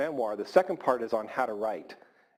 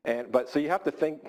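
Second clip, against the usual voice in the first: ordinary talk, nothing marked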